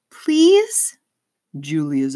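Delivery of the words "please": put-on high, begging girl's plea; pleading young girl. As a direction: plead with tearful eyes. Girl begging her father.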